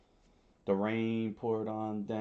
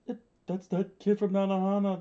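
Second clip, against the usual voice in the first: high-pitched character voice; rebel's voice; 10 semitones higher, 3 dB louder than usual. A narrator's reading. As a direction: pipe up high as a startled rebel. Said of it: "the rebel said".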